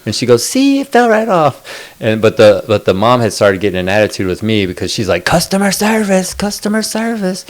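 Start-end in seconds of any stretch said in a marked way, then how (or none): 0.4-1.5 high squeaky mocking quote of the girl. Retelling the girl at the counter, high mocking voice. 5.2-7.4 high nagging singsong of the mom; mocking mom. Mimicking the pushy mom at the counter, high whiny chant. Mom demanding customer service.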